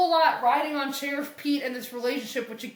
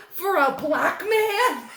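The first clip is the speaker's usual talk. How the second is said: mock dramatic; 6 semitones higher, 4 dB louder, slower than usual. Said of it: "He goes".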